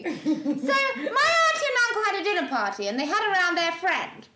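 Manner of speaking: high, put-on horsey posh voice; comic posh voice, pitched high; posh boy's voice. Shown as a demonstration. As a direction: swoop up high like a silly posh type. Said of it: "who actually talks like this".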